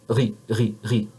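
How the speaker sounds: "ra" with a throaty French "r", mouth open. Shown as a demonstration. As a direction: uvular "ra", tongue tip hidden. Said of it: "one more time".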